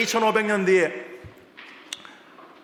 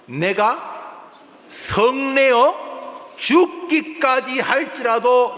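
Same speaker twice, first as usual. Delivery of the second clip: high, loud, very slow defiant retort; angry Jonah; 8 semitones higher, 5 dB louder, much slower than usual. Acted. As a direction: drag it out in stubborn fury. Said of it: "또 성내". (하나님께 대드는 요나, 느리고 높게 화내며)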